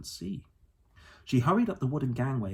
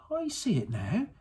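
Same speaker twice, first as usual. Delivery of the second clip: put-on high girl's voice, bursting with the idea; a girl who has just worked it out; suddenly excited; 10 semitones higher than usual. A narrator's reading. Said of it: "suddenly excited".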